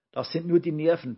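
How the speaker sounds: lightly piped, hurried and nervy; Brausewein. A narrator's reading. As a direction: squeak it out quickly, like a tiny man. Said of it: "piepste Brausewein".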